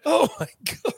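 high, breathless after laughter; after laughing hard. Real, not acted. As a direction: still catching breath from laughing.

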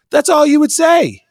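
high emphatic shout; loud, forceful point. Real, not acted.

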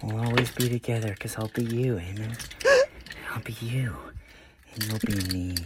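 unsettling whisper into the camera; creepy whisper. Acted. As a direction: whisper it like a stalker in the dark. Creepy whispered video continues.

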